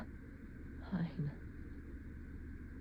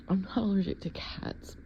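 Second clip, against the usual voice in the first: ordinary talk, nothing marked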